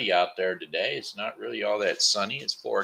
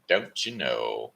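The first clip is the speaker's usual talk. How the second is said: low slow echo; 9 semitones lower, slower than usual. Acted. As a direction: lower and slow, drawn-out answer to itself. Repeats tag question two ways.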